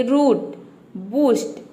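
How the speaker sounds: रूट and बूस्ट with a long, stressed ऊ. Shown as a demonstration. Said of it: "जोर दे के pronounce करना है".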